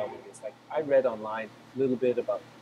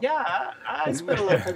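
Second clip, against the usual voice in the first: retold thrill, pitched much higher and louder; delighted high-pitched laughing yes; excited reply; 13 semitones higher, 6 dB louder than usual. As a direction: squeal it out with a laugh, amazed at the coincidence. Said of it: "I was like".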